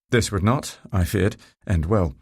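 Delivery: ordinary delivery, nothing marked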